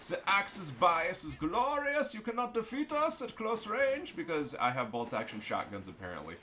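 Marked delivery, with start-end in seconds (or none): 0.1-4.2 put-on high, slow, bombastic German accent. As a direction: proclaim it as a hammed-up German. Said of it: "I'm doing the German accent".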